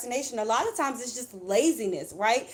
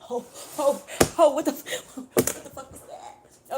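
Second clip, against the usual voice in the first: shocked reaction. Retold or imitated. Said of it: "you gonna be like".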